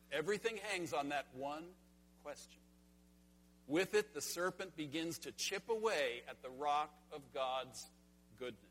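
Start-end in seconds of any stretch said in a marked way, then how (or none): none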